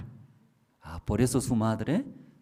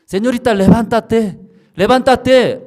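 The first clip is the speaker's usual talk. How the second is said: insistent calling voice; 12 semitones higher, 13 dB louder than usual. Retold or imitated. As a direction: keep calling her to get up.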